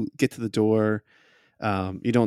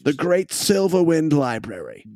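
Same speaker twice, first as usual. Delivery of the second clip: fussy gentleman; 5 semitones higher than usual. Acted. Gentleman NPC voice.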